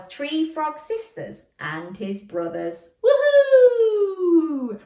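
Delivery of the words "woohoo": very high, loud woohoo; excited cheer. Acted. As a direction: cheer with joy. Frogs arrive to rescue.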